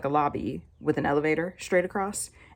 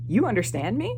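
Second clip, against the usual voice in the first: higher, stunned question; imitated surprise; 5 semitones higher than usual. Landlord finding she understands Korean, imitated surprise. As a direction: ask it in mock disbelief.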